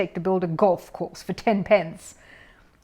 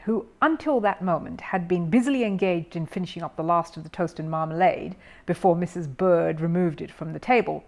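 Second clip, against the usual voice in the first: plain reading voice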